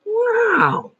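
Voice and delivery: a very high, amazed wow; impressed wow. Pretend. Imagined reader amazed by a vivid word, very high wow. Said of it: "they go".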